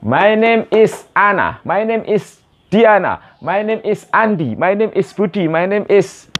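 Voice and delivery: slow, high-pitched drill of the answer; model for repetition. Shown as a demonstration. Teacher models sample answers to repeat, slowly and high. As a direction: lift and stretch each phrase for learners. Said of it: "repeat after me".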